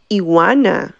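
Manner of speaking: ordinary talk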